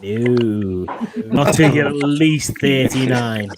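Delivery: low, drawn-out mock-stern no; slow, low fatherly refusal; firm dad refusal. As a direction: slowly lay down the law as dad. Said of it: "I'm like".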